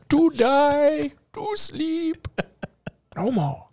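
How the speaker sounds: high, theatrical Shakespeare reading; thespian actor. Acted. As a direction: recite Hamlet like a ham actor. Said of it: "trained thespian actor".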